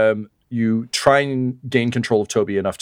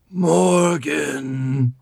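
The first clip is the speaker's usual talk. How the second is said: strangled, higher, drawn-out croak; choked voice; 6 semitones higher than usual. Acted. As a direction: force the name out through a closed throat. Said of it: "a horrible choked voice".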